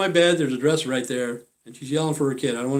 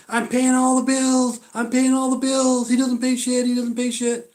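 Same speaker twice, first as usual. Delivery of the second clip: mocking the woman's whiny voice; 10 semitones higher, 4 dB louder than usual. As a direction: parrot her complaint in a mocking voice. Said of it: "she goes".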